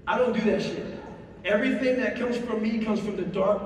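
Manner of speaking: ordinary talk, nothing marked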